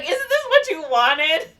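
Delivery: high, loud, drawn-out question; mock exasperated retort. Husband answers his furious wife, mock exasperated retort.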